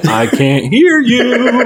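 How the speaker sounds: high, loud, slow sing-out with laughter; mock calling back. Co-host answers the imagined yelling, mock calling back. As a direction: call it out high and drawn out.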